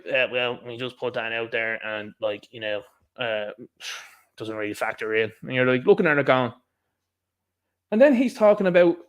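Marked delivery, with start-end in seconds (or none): 0.1-5.3 low, quiet, offhand brush-off; mock dismissive. Officials disregarding thousands of public comments, mock dismissive. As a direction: imitate a shrugging official, ending on a sigh. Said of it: "And it was just disregarded".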